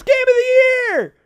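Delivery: high, loud drawn-out yell; yelled shout. Acted. Imitating a stranger yelling at a passing driver, high yelled shout. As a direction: shout it out like someone in the street. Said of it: "somebody yells".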